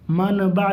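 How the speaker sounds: منبع with a clear n before the b; as written. Shown as a demonstration. Teacher shows pupils the spelling, منبع said with an n. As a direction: sound out the نون in منبع as man-ba. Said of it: "لکھا ہے".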